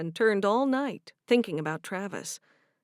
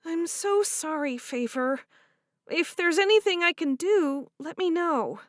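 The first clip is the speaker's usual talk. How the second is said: higher, warm voiced heroine; 8 semitones higher, 3 dB louder than usual. Cherish offering to do the dishes, higher and bright. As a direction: speak up brightly to her friend.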